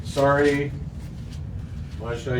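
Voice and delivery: ordinary delivery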